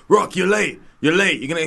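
imitating an angry manager; boss yelling. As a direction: bark it like a furious boss. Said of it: "His boss was like".

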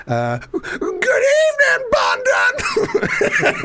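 very high, slow, shouted through laughter; mangled London. Retold or imitated. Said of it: "you just like".

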